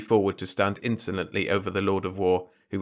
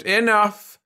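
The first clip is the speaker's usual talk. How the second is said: sharp high loud snap; snapping; 12 semitones higher, 6 dB louder than usual. A narrator's reading. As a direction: cut him off sharply. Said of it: "snapped Trescu".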